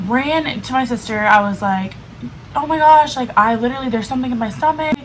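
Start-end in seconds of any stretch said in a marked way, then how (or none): 2.5-5.0 retold panicky rush, higher and louder at the start; tumbling out the words in a mock panic; freaked out. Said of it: "So I, like, freaked out".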